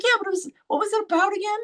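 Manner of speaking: a hint of a nudging friend; the friend innocently pushing her to remember the show, quick and high; prodding friend.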